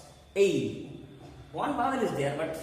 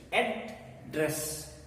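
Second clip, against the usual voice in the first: syllable by syllable. Shown as a demonstration. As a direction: ADDRESS split into ad, dress. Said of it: "there are two syllables".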